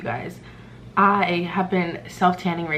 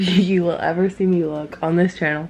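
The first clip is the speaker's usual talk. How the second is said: ordinary delivery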